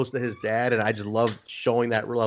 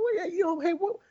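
pitched way up, needling a friend; hammed-up teasing, higher; ribbing high voice. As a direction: rib him in a high mock voice. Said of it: "I go".

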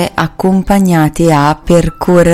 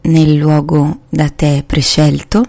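unhurried, calming meditation voice; guided meditation. Acted. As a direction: ease the listener into relaxation, slowly. Leads a guided body scan.

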